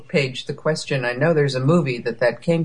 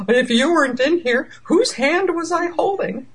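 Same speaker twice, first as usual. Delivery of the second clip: much higher, rising panicky mock voice; alarmed film quote; 14 semitones higher than usual. Podcaster quoting a frightened film heroine, high and alarmed. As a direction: act out a scared realisation, pitched right up. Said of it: "And she says".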